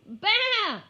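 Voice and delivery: high, loud bleat; lamb bleat. Acted. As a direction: answer with a bright bleat. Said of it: "went the little lamb".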